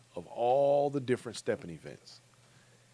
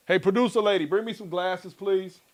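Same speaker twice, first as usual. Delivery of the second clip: higher and louder, calling across the studio; 9 semitones higher, 5 dB louder than usual. Real, not acted. Host calls out to the producer lady for glasses.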